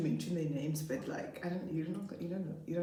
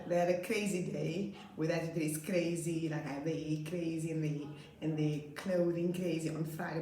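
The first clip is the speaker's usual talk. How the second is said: usual speaking voice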